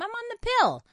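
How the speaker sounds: mock sweet excuse. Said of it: "if somebody said to me".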